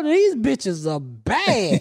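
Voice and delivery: slow, stretched-out appreciation; drawling, impressed reaction; drawn-out admiration. Retold or imitated. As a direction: savour it slowly, drawing out the last word. Admiring quote on seeing the boots.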